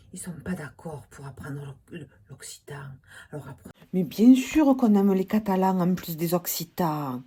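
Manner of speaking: ordinary talk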